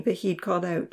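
ordinary delivery

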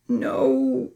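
high-pitched pained moan. A narrator's reading. Charlie lies hurt on the ground, moaning. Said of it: "Charlie moaned".

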